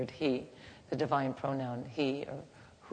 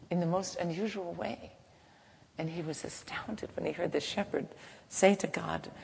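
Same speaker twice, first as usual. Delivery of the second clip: normal talking voice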